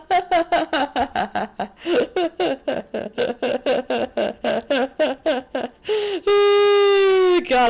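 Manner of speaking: rapid high staccato laugh ending in a squeal; long laughing fit. Real, not acted. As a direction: laugh in a long rattling burst, then squeal.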